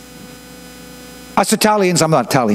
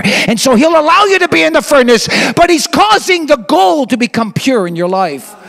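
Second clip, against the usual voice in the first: higher, urgent sermon push; rising preaching; 7 semitones higher than usual. Acted. Preacher telling the flock about the furnace, fervent. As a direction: lift it up like a preacher.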